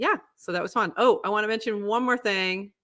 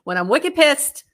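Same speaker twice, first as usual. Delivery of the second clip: louder, higher Boston accent; 7 semitones higher, 6 dB louder than usual. Shown as a demonstration. Warning listeners her accent slips out when angry, Boston accent. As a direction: punch "wicked pissed" in Boston slang. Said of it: "If you hear my Boston accent".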